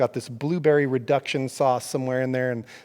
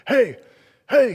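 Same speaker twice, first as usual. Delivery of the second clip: mock yell; 11 semitones higher, 7 dB louder than usual. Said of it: "he's yelling at me".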